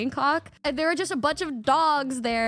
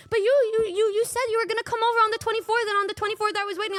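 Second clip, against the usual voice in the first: put-on rushed whiny girlfriend; 7 semitones higher, much faster than usual. Retelling Michi's fast complaint.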